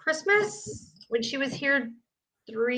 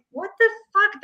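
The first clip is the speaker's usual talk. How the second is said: indignant outburst; 10 semitones higher than usual. Retold or imitated. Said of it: "I was like".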